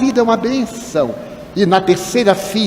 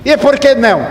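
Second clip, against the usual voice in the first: high, sharp retort in a retold quarrel; indignant challenge; 6 semitones higher, 4 dB louder than usual. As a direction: fire the question back, acting affronted. Acted quarrel, man challenges him.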